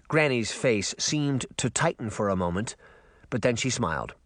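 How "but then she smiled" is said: ordinary delivery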